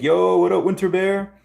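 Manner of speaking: excited greeting. Real, not acted. Greeting a chat viewer excitedly.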